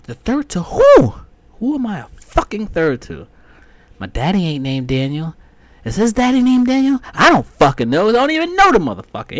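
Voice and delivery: high, loud incredulous rant. Retold or imitated. Ranting about being a third, high and loud. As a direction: demand who you're named after.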